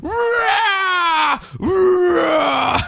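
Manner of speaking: high, long mock roars of effort; straining yell. Superman keeps trying to escape, hammed-up straining yell. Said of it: "And Superman keeps going".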